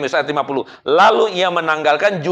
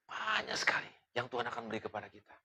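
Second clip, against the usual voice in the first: whispered promise; 3 semitones lower, 19 dB quieter than usual. Acted. Sermon whisper.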